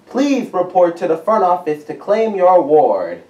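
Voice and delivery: announcement over a PA speaker; loud loudspeaker announcement; school intercom. Real, not acted.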